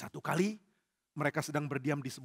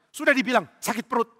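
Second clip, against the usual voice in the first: high, loud exasperated retort; acted irritation; 7 semitones higher, 8 dB louder than usual. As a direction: snap back at a nosy underling. Saul snaps at questioning soldier.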